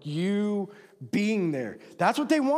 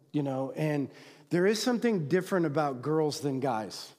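ordinary delivery

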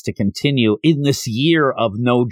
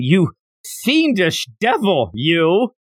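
mock heroic yell; 6 semitones higher, slower than usual. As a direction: throw it at the villain, pitched way up. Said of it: "Yamcha's yelling".

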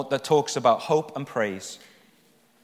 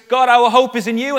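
louder, higher cry to God; fervent declaration; 7 semitones higher, 9 dB louder than usual. Acted. Preacher acts out the people addressing God, fervent. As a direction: proclaim it with conviction.

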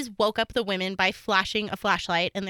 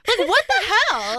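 incredulous; 11 semitones higher, 5 dB louder than usual. Real, not acted. Reacting to creepy high voice.

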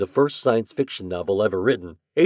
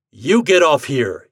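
slow, loud, firm order; command; 5 semitones higher, 8 dB louder, slower than usual. A narrator's reading. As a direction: order him out deliberately. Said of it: "he commanded".

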